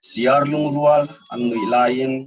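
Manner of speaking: measured verse, a pause after every line; poetry recitation. Acted. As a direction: recite line by line, lingering on the phrase endings. Recites second poem.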